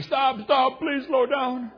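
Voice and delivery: high, loud panicked pleading; kids screaming. Retold or imitated. Terrified kids begging football players to stop, loud high screams. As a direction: beg them to stop like a scared kid. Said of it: "But now they're screaming".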